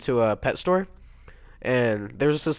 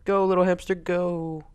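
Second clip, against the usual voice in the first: high-pitched cheering; sing-song encouragement, raised pitch; urging on; 8 semitones higher than usual. Real, not acted. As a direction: egg on a tiny racer. Urging game hamster along.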